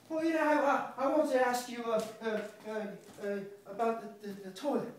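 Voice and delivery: high, stammering nervous voice; nervous rambling. Acted. A nervous man stammers about the toilet tank, high and jittery.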